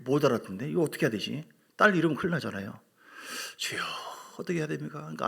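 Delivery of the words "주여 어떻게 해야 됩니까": desperate whispered plea; whispered prayer. Retold or imitated. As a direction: murmur a prayer in panic. Desperate prayer for his daughter. (간절한 속삭임 기도)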